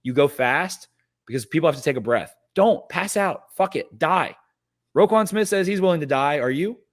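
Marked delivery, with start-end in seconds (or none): 2.5-6.8 higher, pumped-up rallying cry; rally the fans, voice climbing high; fired-up hype. Real, not acted. Hyping fans to yell for Ravens.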